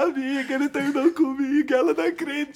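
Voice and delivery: mock sobbing plea to a friend, high-pitched; tearful retelling. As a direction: tell the breakup to a friend through retold tears. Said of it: "eu cheguei chorando".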